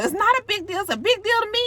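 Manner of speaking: high-pitched mock arguing; hammed-up high protest; imitated upset wife. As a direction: bicker back as the upset wife. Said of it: "So she's all upset".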